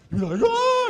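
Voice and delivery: a sudden, high, loud yelp of shock; high startled "Ah!" at the freezer; frozen shocked yell. Shown as a demonstration. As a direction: pull the frozen face and yelp. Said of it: "Yeah, exactly like that".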